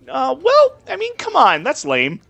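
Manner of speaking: high whiny complaint; exasperated high protest. Real, not acted. Game master to player, high-pitched whiny protest.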